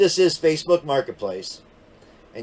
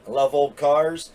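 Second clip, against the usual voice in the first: ordinary talk, nothing marked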